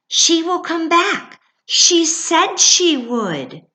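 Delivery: very high, loud fawn; insistent fawn. Acted. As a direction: hold on to hope, insisting.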